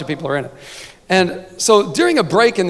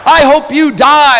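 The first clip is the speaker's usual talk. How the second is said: higher, louder, slow mock cheerleader voice; hammed-up cheerleader threat; 8 semitones higher, 6 dB louder, slower than usual. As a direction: read it like a sweet, spiteful cheerleader note. Mock cheerleader hate mail.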